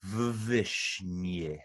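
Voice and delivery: "Vvishnyich" very slow, V doubled; double V. Shown as a demonstration. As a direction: lean on a long doubled V in "Vvishnyich". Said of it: "we'll just sort of do a double V".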